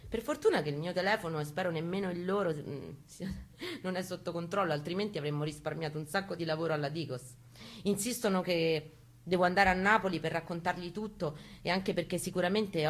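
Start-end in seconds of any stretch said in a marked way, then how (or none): none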